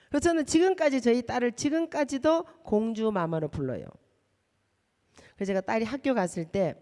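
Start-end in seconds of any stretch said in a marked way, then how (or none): none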